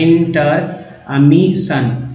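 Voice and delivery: Intermission very slowly, broken into in-ter-mi-shan; teacher slowly sounding out intermission; syllable by syllable. Shown as a demonstration. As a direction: sound out intermission part by part, drawn out. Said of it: "पढ़ा जा सकता है".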